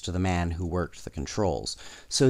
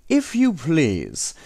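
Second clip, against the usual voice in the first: mock polite robber; 7 semitones higher, slower than usual. A narrator's reading. Robber mock-politely takes suitcase.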